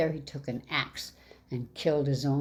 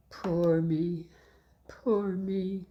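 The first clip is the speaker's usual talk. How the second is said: sighed; 4 semitones higher, much slower than usual. Acted. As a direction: sigh it to yourself, resigned. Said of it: "sighed Little Claus".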